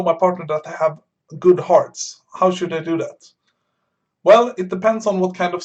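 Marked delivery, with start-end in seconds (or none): none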